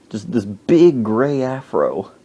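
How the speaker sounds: usual speaking voice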